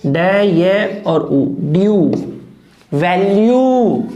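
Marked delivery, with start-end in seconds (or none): 2.9-4.0 वैल्यू drawn out slowly as "vel-yoo"; teacher modelling वैल्यू; slow demo pronunciation. Shown as a demonstration. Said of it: "क्या word है".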